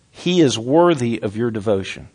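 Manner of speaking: ordinary talk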